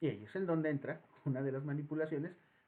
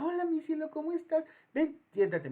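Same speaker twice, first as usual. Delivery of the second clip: much higher, honeyed greeting; sweet taco lady; 11 semitones higher, 5 dB louder than usual. Retold or imitated. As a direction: welcome him like a small child. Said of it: "como si fueras una criatura de poca edad".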